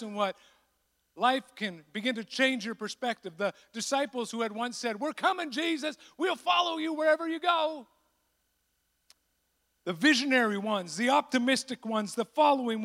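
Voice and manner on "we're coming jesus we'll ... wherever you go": eager shout. Acted. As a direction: call out like a zealous follower. Eager disciples' past promise.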